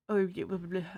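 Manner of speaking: normal talking voice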